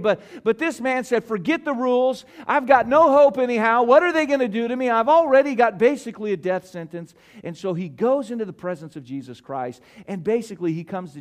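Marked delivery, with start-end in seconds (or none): 1.2-7.1 louder, pushing, a touch faster, nothing to lose; hammed-up reckless despair, voicing the leper; defiant desperate leper. Preacher voicing the leper who breaks the rules, desperate.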